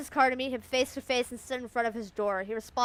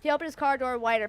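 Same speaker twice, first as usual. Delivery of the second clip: ordinary delivery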